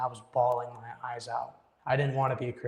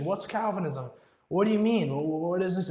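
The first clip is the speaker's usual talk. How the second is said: higher-pitched, baffled questions; confused mom; 5 semitones higher than usual. Retold or imitated. Mom who had no clue questioning her kid, confused. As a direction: imitate a bewildered mother, pitched up. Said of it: "She's so confused".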